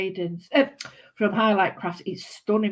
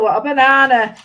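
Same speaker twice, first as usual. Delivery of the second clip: ordinary talk, nothing marked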